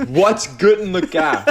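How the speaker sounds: incredulous mock-British repeat; mocking British echo. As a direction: echo the British accent back, drawn out. Mockingly echoes the British impression.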